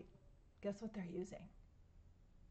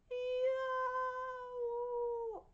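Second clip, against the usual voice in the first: a very high, long held yow; jet roar. Shown as a demonstration. Telling kids about a jet: high, long yow. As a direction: scream it out like a jet overhead. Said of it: "A jet goes".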